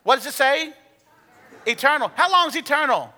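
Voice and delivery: high, loud challenge to the crowd; energetic call to respond; excited quizzing. Real, not acted. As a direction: push them for the answer. Pastor quizzing congregation.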